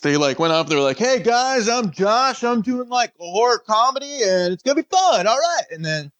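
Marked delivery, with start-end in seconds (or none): 1.0-5.7 high, bubbly self-intro voice; hammed-up cheery pitch guy; mock host intro. Mimicking another actor's intro.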